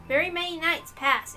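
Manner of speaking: usual speaking voice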